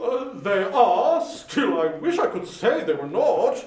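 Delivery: slow, courteous, rounded voice; lightly voiced charity gentleman; polite portly gentleman. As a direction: greet him with formal politeness. Gentleman answers.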